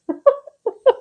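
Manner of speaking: sudden high-pitched giggle; high squeaky laugh. Real, not acted. Laughs at kitchen mess.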